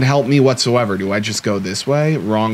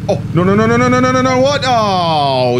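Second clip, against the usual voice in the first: rapid-fire high string of refusals, falling at the end; panicked disbelief; 12 semitones higher, 5 dB louder than usual. Real, not acted. Player reacts to missed bus, high panicked disbelief. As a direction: climb higher and louder, then let the last cry slide down. Player sees bad event.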